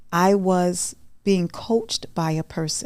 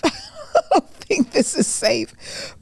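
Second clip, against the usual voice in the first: much higher and slow, laughing through it; 10 semitones higher, much slower than usual. Retold or imitated. Joking about the dungeon gym, laughing high and slow. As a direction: crack up while saying it.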